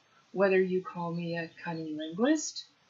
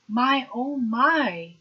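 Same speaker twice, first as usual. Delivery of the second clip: high, loud and slow, drawn-out amazement; old professor marveling; 7 semitones higher, 7 dB louder, much slower than usual. Retold or imitated. Old professor teasing student before class, high, loud, slow marveling. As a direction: savor each word like an impressed old professor. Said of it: "and he says".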